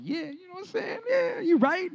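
high, smug mock self-satisfaction; hammed-up vain preacher; smug agreement. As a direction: lap up the praise in a high, pleased voice. Preacher mocks his own vanity.

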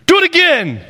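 put-on high, eager child plea; kid begging. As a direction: beg like a little boy. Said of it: "Do it again, Dad".

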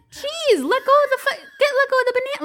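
high, loud exasperated plea. Retold or imitated. Parent fed up with the banana fuss, exasperated and high. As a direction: throw up your hands, pitch way up.

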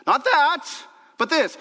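high, loud preaching punch; emphatic repeat. Real, not acted. Preacher drives Paul's contrast home to the church, loud and emphatic. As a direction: hammer both halves of the contrast.

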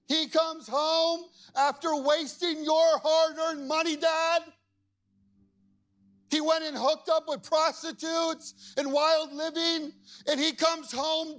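high, slow, loud indignant shouting; frustrated shout. Acted. Jealous older brother shouting at his father, high and slow. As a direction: yell your outrage at dad's unfairness. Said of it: "Now he's really frustrated".

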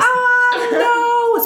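very high, stretched-out mock shriek of no; incredulous refusal. Turning down the man on the bed, squealed high in disbelief.

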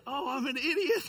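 high, loud self-mocking laugh; laughing at himself. Youth pastor realizing his mistake, laughing at himself. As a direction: laugh at your own blunder.

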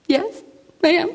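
mock-tearful, high-pitched reply; retold tearful answer. Stranded driver answering a stranger, mock tearful. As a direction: play at answering through tears. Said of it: "I'm just crying".

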